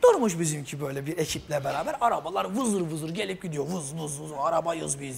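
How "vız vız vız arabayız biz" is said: low, soft buzzing car voice; lightly voiced cars whizzing by; voicing passing cars. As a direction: play cars humming along quietly. Voicing the cars zipping past.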